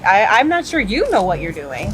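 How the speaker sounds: pointed stress jumping up on "you". Acted. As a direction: throw the doubt back, punching "you". Actress turns the accusation back.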